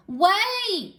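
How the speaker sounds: high yelled wait; yelled call. A narrator's reading. Miss Lurleen yelling after the fleeing Bubba. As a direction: call him back across the room. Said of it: "she yelled".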